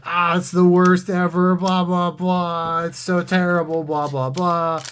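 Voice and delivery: loud, high droning whine; mock complainer. Someone trashing a new set, mocking complainer. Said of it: "someone's just like".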